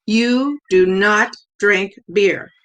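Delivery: "You do not drink beer" slowly, every word stressed separately; each word emphasized. A narrator's reading. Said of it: "I emphasized each word".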